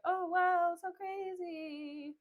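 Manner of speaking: high, drawn-out fake wonder; mock-surprised singsong; feigned amazement. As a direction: play at being astonished, unconvincingly. Tranio feigning surprise in retelling.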